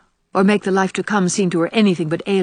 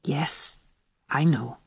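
soft, low-pitched reply; 4 semitones lower, 6 dB quieter, much slower than usual. A narrator's reading. Anne admitting the truth to dying Ruby, low tone. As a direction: answer quietly, dropped low. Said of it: "answered Anne in a low tone".

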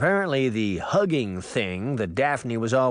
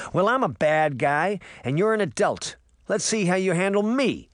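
put-on higher-pitched challenging boy; boy's retort; 8 semitones higher than usual. A narrator's reading. Puck squares up to Uncle Jake, defiant retort. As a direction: throw the challenge back, pitch climbing. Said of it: "Puck retorted".

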